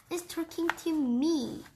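high-pitched, disbelieving strange voice. A narrator's reading. Grandma reacting to a talking cat, strange voice. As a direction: pitch it up, oddly. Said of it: "in a very strange voice".

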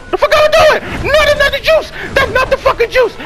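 high, loud, exasperated outburst; panicked yelling. Real, not acted. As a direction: shriek in disbelief at the wrong move. Panic over wrong item.

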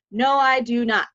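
laughing through the words. Retold or imitated. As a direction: break into a laugh. Laughing recalling nervous answer.

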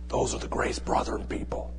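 soft whispered aside; a hushed confidential whisper. Acted. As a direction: whisper it like a secret you shouldn't share. Secret aside in a joke.

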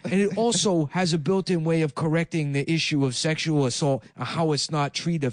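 mock foreign-accented politician; Andrew Yang. Presidential candidate announcing a giveaway, mock foreign accent. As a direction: announce policy in a put-on broken-English accent. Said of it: "using that classic Yang".

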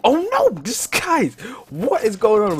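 high, loud slow wail; alarmed. Real, not acted. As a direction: cry out in alarm. Creatures attack again.

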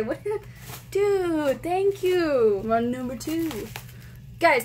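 normal talking voice

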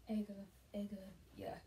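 soft whispered chant; whispering. Real, not acted. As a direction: breathe the words out. Whispering along to song.

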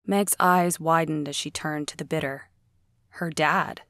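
usual speaking voice

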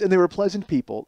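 ordinary delivery

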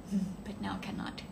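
whispered and slow, wistful; regretful whisper. Real, not acted. Streamer wistfully tells viewers she can't travel now, whispering. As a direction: let it fade to a breath.

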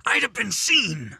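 husband pleading his case, voice lifting; agitated. Acted. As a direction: defend yourself in a rising panic. Panicked justification to wife.

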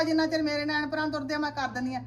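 usual speaking voice

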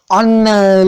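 yüksek ve yüksek sesli ünlem; ani bağırış. Real, not acted. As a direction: hayal kırıklığıyla bağır. Blok kırılınca ünlem. (kırılan eşyaya tiz, yüksek bir çığlık)

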